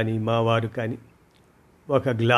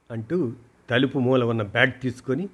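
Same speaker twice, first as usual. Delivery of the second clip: plain reading voice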